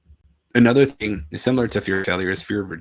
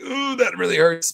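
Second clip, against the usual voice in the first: a high, slow, hammed-up wince of pain; mock hurt reaction; 10 semitones higher, 5 dB louder, slower than usual. Reacting to the billionaire's refusal, mock hurt. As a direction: play the sting of a billionaire's brush-off. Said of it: "And I was like".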